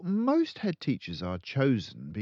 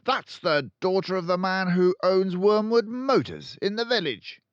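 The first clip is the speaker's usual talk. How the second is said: loud, high barked interruption; Trunchbull barking; 11 semitones higher, 7 dB louder than usual. A narrator's reading. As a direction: cut her off with a bark. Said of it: "Miss Trunchbull barked".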